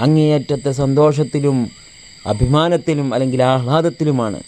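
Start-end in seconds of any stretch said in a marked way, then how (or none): none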